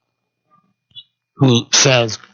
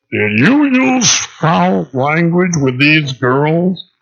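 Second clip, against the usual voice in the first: high, slow timid voice; the punk manager's squeaky inquiry; nerdy kid manager; 4 semitones higher, much slower than usual. Retold or imitated. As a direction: ask it like a nervous teen clerk. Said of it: "stands up and goes".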